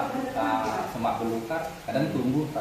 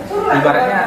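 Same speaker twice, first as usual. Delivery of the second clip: emphatic burst; 14 semitones higher, 8 dB louder than usual. Real, not acted. Emphatic sermon peak.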